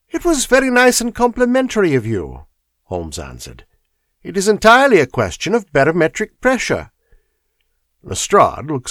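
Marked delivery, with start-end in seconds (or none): 0.1-2.5 much higher, lilting Holmes. Acted. Holmes voiced in story. 4.2-6.9 much higher, lilting Holmes. Acted. Holmes voiced in story.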